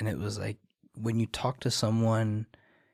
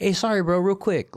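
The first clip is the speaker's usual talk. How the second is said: high, loud passing excuse-me; breezy buddy-to-buddy interruption; quick casual apology; 9 semitones higher, 7 dB louder than usual. Shown as a demonstration. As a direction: toss off a sorry to get by. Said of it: "but like".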